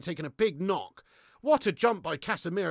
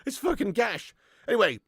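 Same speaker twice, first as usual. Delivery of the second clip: ordinary delivery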